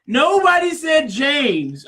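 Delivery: exasperated shout at James in an argument; heated shout. Real, not acted. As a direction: shout him down, word by word.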